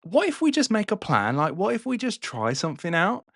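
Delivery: lightly voiced plotting Allies; scheming Brits. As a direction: pitch the idea like a sneaky schemer. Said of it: "so they were like".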